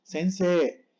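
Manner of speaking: sensei ending on a long E; silent final I. Shown as a demonstration. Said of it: "como doble E".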